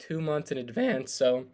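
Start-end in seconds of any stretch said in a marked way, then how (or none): 1.1-1.4 voice crack. Real, not acted. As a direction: voice cracking mid-word. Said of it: "I don't know what that voice crack was".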